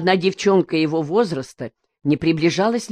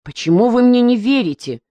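higher, louder puzzled question; surprised; 6 semitones higher, 4 dB louder than usual. A narrator's reading. Misha asking the family why they doubt him, astonished. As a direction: ask it genuinely astonished. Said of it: "спросил удивлённый Миша".

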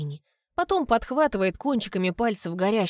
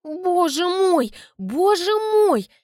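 slow, high, loud joyful cry; overjoyed; 10 semitones higher, 5 dB louder, much slower than usual. Acted. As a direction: cry out in delight, stretched. Albina overjoyed.

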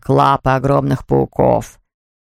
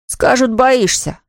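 put-on high, slow child's admission; boy confiding; 7 semitones higher, slower than usual. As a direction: admit it slowly as a child, pitched up. Мальчик жалуется папе. (мальчик признаётся, медленно, высоким голосом)

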